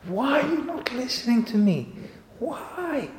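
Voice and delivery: screaming at God. Retold or imitated. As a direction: wail the whys up high at God. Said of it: "I was screaming at God".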